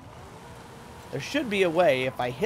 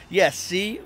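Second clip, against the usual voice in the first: normal talking voice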